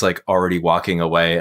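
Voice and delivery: ordinary talk, nothing marked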